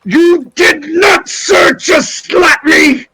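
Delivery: high, slow, indignant wizard outburst. Acted. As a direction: sputter in hammed-up outrage at a slap. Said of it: "you will not raise your voice".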